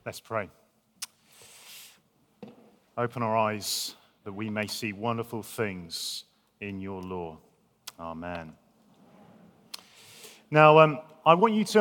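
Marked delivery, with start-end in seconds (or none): 0.1-8.5 soft, low, hushed prayer. Real, not acted. Pastor praying softly before preaching to the festival, hushed prayer. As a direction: pray quietly over the listeners.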